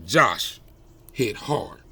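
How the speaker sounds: plain reading voice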